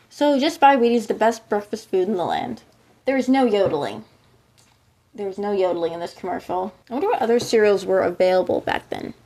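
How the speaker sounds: ordinary delivery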